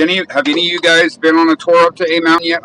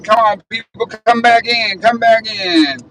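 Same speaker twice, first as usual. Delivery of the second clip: high, sing-out call to viewers; playful calling out; 8 semitones higher than usual. Pretend. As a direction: call everyone back in, higher. Calling viewers back to the stream.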